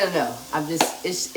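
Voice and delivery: ordinary talk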